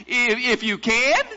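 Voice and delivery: stammered start, then "can" rises in challenge; incredulous question. Retold or imitated. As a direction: repeat it in disbelief, "can" swinging upward. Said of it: "I imagine he said it just like that".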